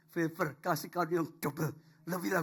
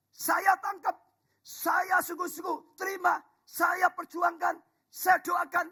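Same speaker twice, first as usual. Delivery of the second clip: rising, loud proclamation, higher and louder; full-force preaching; 11 semitones higher, 5 dB louder than usual. Acted. Pastor to the congregation, proclaiming at full force. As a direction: preach it out to the whole church.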